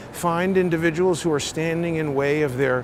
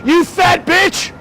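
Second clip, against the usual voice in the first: screaming much higher and louder in real rage; furious shout; 11 semitones higher, 7 dB louder than usual. Real, not acted. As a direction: yell him off the sidewalk at full force. Real street fight with cyclist.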